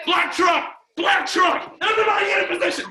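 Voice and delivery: high, loud alarm, speeding up; urgent calling out. Retold or imitated. Retelling friends spotting headlights, urgent shouting. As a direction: shout the warning to the whole crew.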